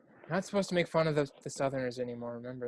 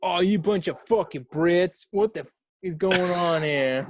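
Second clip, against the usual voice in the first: drawn-out, raised American [name] voice; 4 semitones higher, 4 dB louder, slower than usual. Pretend. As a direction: play a loudly griping American [name], dragging the words.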